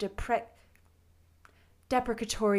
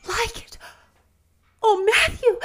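put-on very high, tearfully joyful girl; overcome with emotion; 13 semitones higher, 9 dB louder than usual. A narrator's reading. Anne thanks Matthew for the dress, overwhelmed. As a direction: gasp it, then swell with feeling. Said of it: "eyes had suddenly filled with tears".

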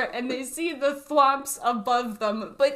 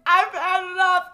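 higher and louder put-on voice, exasperated; fed-up villain; 6 semitones higher, 5 dB louder than usual. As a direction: snap like he's done with these kids. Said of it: "And he's like".

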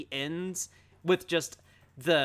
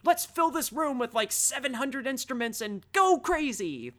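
higher-pitched mock enthusiasm; John going wild; 7 semitones higher, 3 dB quieter than usual. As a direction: play an excitable John, without shouting. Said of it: "John is about, like".

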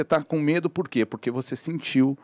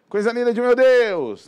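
delighted exclamation; 9 semitones higher, 7 dB louder, slower than usual. Retold or imitated. Admiring the goal in retelling.

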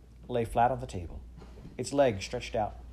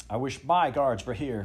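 cried out; 4 semitones higher, 6 dB louder than usual. A narrator's reading. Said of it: "cried the Queen".

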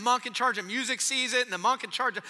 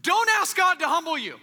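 higher, louder retold warning; urgent warning; 6 semitones higher, 5 dB louder than usual. Warning a friend who prayed, loud and urgent. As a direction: warn a friend with comic urgency.

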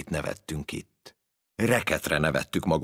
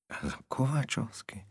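higher, softer, bewildered repeat; "Ez a Kovácsovszki?" repeated higher and softer, wondering; uncertain mumble, rising; 8 semitones higher, 6 dB quieter than usual. A narrator's reading. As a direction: ask it again in quiet disbelief. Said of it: "bizonytalan hangon motyogta maga elé".

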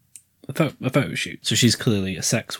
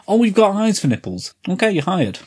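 mock chirpy interviewer; 7 semitones higher than usual. Acts out the interview scene.

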